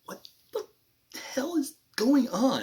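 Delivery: breathy start swelling into high, loud bafflement; baffled disbelief. Acted. Acting out a viewer's reaction, baffled disbelief. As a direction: stretch it out slowly in mock bewilderment.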